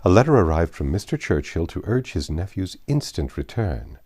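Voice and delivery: ordinary delivery, nothing marked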